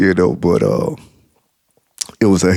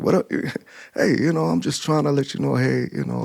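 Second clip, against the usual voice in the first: higher, softer, hurried excuses; sheepish fumbling; 5 semitones higher, 7 dB quieter, faster than usual. Retold or imitated. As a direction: mumble your way out, caught in a lie.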